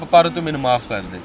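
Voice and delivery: ਪਰ ਤੂੰ ਮੈਨੂੰ ਮਾਫ ਕਰ ਦੇ said low and humble; pleading apology. Acted. Husband apologising to his wife.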